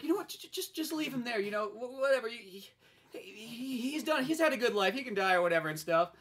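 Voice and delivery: put-on high, offhand girl's voice; dismissive girl. Girl tells Don to abandon the dog, high and dismissive. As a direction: wave the dying dog away.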